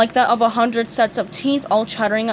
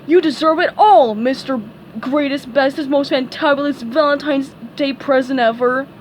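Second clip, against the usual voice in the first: higher, loud sarcastic rant; mock furious accusation; 4 semitones higher, 5 dB louder than usual. A narrator's reading. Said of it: "Patrick cried".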